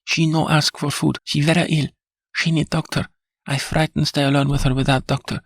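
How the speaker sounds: a lightly voiced Spanish maid, protesting; indignant Spanish accent. A narrator's reading. Maid pleads for a doctor.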